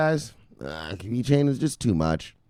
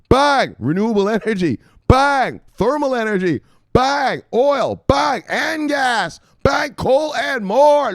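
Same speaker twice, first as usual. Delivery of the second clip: louder and higher, punching each bang; hyped reading; 13 semitones higher, 8 dB louder, slower than usual. Real, not acted. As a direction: fire off each item like a gunshot. Excitedly reading expansion list.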